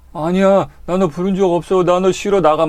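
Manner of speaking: high, loud panicked cry of no; shocked refusal. Retold or imitated. Patient rejecting the ghost, high frightened shout. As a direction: shout the ghost away in terror. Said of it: "막 이렇게 할 거 아니야".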